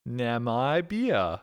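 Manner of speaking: Namibia stretched out slowly, na-MY-bia; slow careful. Shown as a demonstration. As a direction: drag out Namibia, long i in the middle. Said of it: "It really has an I after the M".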